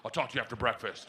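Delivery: low, quick, hushed undertone; confidential aside. Retold or imitated. Daughter secretly to dad under the table, confidential. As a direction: slip it out low and fast.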